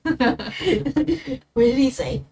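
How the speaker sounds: high, slow, laughing through it. Real, not acted. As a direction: giggle out the question. Laughing at garbled impression.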